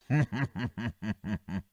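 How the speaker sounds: breaking into a quiet laugh; low stifled chuckle. Real, not acted. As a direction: lose the poker face with a soft chuckle. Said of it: "lá se vai a minha poker face". (risadinha contida)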